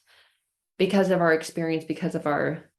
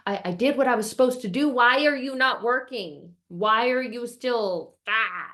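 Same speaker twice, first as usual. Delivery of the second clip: raised-pitch frustrated questioning; exasperated; 4 semitones higher than usual. Retold or imitated. Demanding to know why the fixed alarm still beeps, exasperated. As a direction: rush in, then drag out the complaint. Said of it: "I'm not irritated, I'm not annoyed".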